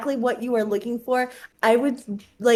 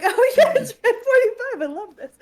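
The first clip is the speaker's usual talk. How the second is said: squeaky, giggly fake enthusiasm; high laughing mock delight; 11 semitones higher, 4 dB louder than usual. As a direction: gush through laughter. Mocking someone's delight, laughing.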